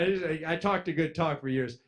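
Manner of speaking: ordinary delivery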